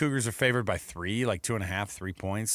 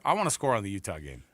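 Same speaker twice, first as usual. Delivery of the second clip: ordinary delivery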